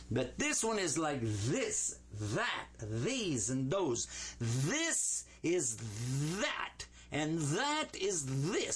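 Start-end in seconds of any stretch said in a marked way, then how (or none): 1.2-8.8 heavy buzzing TH in this, that, these, those. Shown as a demonstration. Teacher gives pupils examples, heavy drawn-out TH in each word. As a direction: slowly lean on every TH in this and that. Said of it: "So give it a heavy T H sound".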